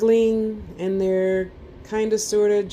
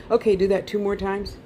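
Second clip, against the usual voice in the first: ordinary delivery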